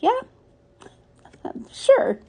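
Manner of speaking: flat yeah then sure jumps way up; mock agreement, eye-roll sure; sarcastic. Sarcastic reply to dad's scribble.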